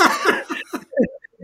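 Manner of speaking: breathy high laugh; burst of laughter. Real, not acted. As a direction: laugh out in disbelief. Reaction to lieutenant's story.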